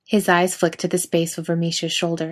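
ordinary delivery, nothing marked

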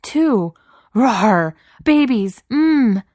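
high, loud mock-menacing outbursts; desperate wailing. A narrator's reading. Beast flailing to frighten a laughing Cook, wailing. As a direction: blurt threats in a frantic wail. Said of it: "the beast wailed".

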